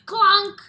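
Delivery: loud, high clunk; clunk sound effect. Acted. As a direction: belt out the clunk.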